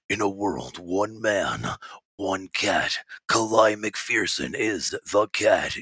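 low, drawn-out trailer narrator; movie trailer voice. Shown as a demonstration. Showing off his movie voice, low trailer announcer. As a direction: do a deep, dramatic "in a world" announcer. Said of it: "in a world movie voice".

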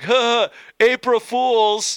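drawn-out smug April Fools; drawling the punchline slowly; slow smug reveal. Retold or imitated. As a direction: savor the prank reveal slowly. Prank reveal in story.

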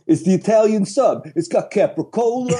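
mock a Brooklyn Italian commercial actor; hammed-up Brooklyn Italian, pitched high; Brooklyn Italian accent. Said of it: "like, Brooklyn, you know, Italian scene".

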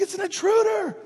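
high, panicked imitation of wife; mock panic. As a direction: cry out as if warning of danger. Voices wife panicking in night terror.

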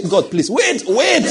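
high, rising mock plea; pleading believer. Preacher acting a panicked believer, high plea. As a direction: beg God to wait, rising. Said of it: "you say".

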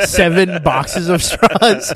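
usual speaking voice

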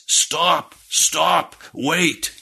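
high mock holler to halt a wagon; retold yelling. Rider calling to the departing wagon, imitated high yell. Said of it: "yelling at the top of his voice".

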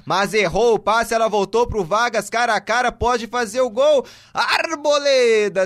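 rising pitch as the chance builds; excited commentary. Real, not acted. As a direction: call the chance with growing excitement.

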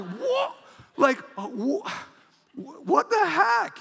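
high squeaky stammer of shock; stunned disbelief. Retold or imitated. Pastor finding cash in a stranger's letter, high stunned sputter.

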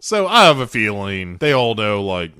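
usual speaking voice

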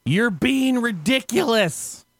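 high, drawn-out retold yell; mock scream. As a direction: imitate a man yelling in exasperation. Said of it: "He proceeds to scream at her".

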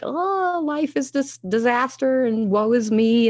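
high, drawn-out mock wail; hammed-up woe-is-me; slow, theatrical moan of self-pity; self-pitying lament. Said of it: "totally in my story, totally like".

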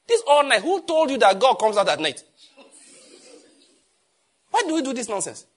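high, very loud challenge; indignant outburst. Real, not acted. Pastor railing against all-night church services, indignant and shouting. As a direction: thunder it out in disbelief, voice pitched right up.

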